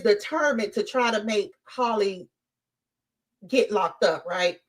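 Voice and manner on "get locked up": usual speaking voice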